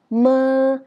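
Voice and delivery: "mơ" long and high. Shown as a demonstration. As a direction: hold "mơ" up, then let go. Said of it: "cao tông hơn". (đọc "mơ" kéo dài, cao tông)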